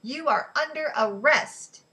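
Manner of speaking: loud, high, slow official order; stern, measured bullhorn command; stern bellow. A narrator's reading. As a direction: bellow each word as if over a bullhorn. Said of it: "a stern voice bellowed over a bullhorn".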